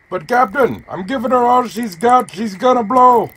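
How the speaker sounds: panicked ship engineer; Scotty voice. Pretend. Scotty reply in Star Trek bit.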